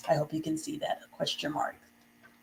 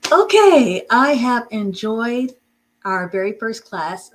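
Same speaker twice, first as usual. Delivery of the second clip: excited; 4 semitones higher, 8 dB louder, slower than usual. Real, not acted. Teacher closing class cheerfully.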